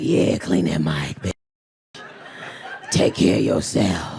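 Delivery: mock deep macho voice, slow; imitating the men in the room, low and slow; deep dude voice. Comic imitating the men's deep voices. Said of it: "They voice ain't even normally that deep".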